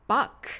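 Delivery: バック ending in a K sound; English Bach. Shown as a demonstration. Teacher modelling the composer's English name, バック with final K. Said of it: "Kで発音されます".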